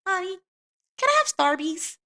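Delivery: put-on high, loud little child begging; whiny kid voice. Kid pestering tired mom after bad day, high childish voice. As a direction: pitch way up like a small kid asking.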